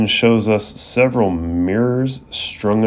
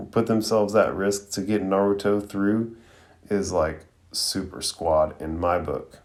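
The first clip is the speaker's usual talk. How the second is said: ordinary talk, nothing marked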